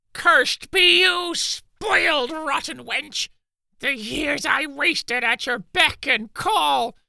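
high, slow furious curse; laying a curse. Acted. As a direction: spit the curse at them. Servant curses prince and princess.

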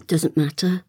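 plain reading voice

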